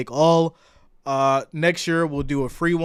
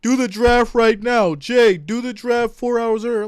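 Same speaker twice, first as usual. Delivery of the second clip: higher, louder mock demand from impatient chat; mock pushy viewer; 8 semitones higher, 7 dB louder than usual. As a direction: imitate a pushy fan nagging Jay. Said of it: "People are like".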